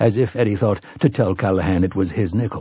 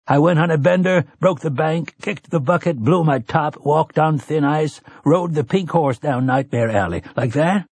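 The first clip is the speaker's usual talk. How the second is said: higher, lively, teasing list; playful rattling off; 7 semitones higher than usual. Acted. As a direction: rattle them off with a grin. Callahan reels off idioms playfully.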